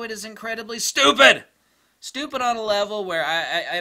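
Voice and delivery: ranting at a dumb tip; exasperated shout. Real, not acted. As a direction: blast the word stupid in disbelief. Rant about workflow.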